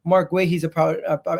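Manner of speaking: normal talking voice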